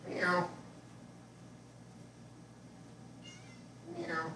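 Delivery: high imitated meow to the cat; mock meow. Meowing to lure the cat.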